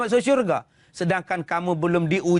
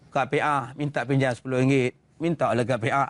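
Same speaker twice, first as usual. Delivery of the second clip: demonstrated bad request; 7 semitones lower than usual. Shown as a demonstration. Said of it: "Ada minta macam ni".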